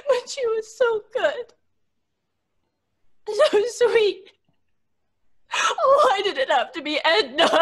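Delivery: crying, voice cracking; tearful grief. Acted. Grieving Florence crying for sweet Edna. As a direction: choke on the grief.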